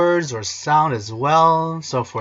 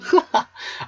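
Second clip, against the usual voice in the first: laughing at himself. Real, not acted. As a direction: burst of high giggling. Laughs at own singing.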